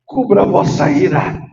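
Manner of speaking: loud, high, shouted sermon climb; full-force preaching. Acted. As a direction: preach it hard to the whole church.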